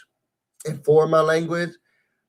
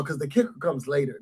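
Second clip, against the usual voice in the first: hushed teaser; 3 semitones lower, 10 dB quieter than usual. Real, not acted. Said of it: "remember what I'm saying".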